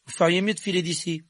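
high, loud warning; forced confidence. A narrator's reading. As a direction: put on a bold front. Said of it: "s'efforçant de paraître plus assuré".

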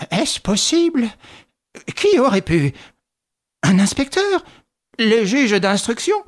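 slow, stunned questions; bewildered widow. A narrator's reading. Widow to her nephew, stunned and suspicious.

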